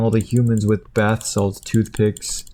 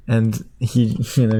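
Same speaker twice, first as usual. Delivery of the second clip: ordinary talk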